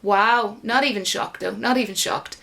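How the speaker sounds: ordinary talk, nothing marked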